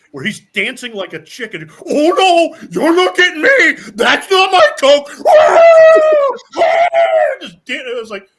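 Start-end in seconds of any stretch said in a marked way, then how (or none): 1.9-5.1 high, very loud frantic mock shouting; deranged diatribe. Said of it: "this mentally deranged diatribe". 5.1-7.4 long high wail at full volume; wordless mock shriek of someone gone berserk; deranged shriek. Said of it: "completely deranged".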